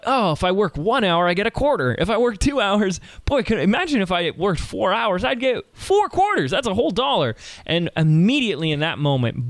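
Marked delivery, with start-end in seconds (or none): 0.1-7.3 put-on high, eager kid doing sums; a thrilled boy counting coins; excited kid. Kid counting his quarters.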